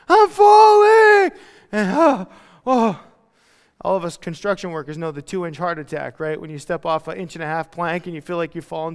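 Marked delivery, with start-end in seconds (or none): 0.1-3.0 much higher, louder, drawn-out shout; mock scream. Pushed over the edge by God, a hammed-up falling yell. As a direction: yell like you're falling off a cliff.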